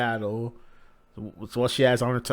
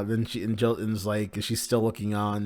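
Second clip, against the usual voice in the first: plain reading voice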